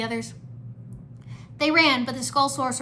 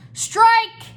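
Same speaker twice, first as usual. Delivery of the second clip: high, very loud triumphant call; yell; 12 semitones higher, 13 dB louder than usual. A narrator's reading. Cole hurls a rock at skeletons, triumphant yell. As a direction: yell it like a bowler. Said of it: "he yelled".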